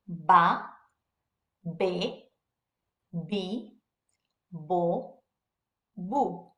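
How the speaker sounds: Spanish V said as B: Ba, be, bi, bo, bu. Shown as a demonstration. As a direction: say each V syllable with a B: Ba, be, bi, bo, bu. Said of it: "the V sounds just like a B".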